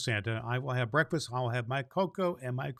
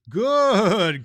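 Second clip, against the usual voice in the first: loud, high, pleased shout; pleased cry; 8 semitones higher, 13 dB louder than usual. Acted. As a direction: burst out with delight. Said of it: "cried Claus, well pleased".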